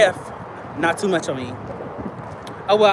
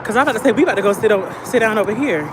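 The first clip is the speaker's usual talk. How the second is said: ordinary delivery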